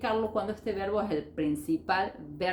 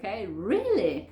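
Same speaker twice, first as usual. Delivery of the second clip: drawn-out, surprised "really"; raised intonation. Shown as a demonstration. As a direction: lift the pitch up through a long "really". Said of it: "la entonación para arriba".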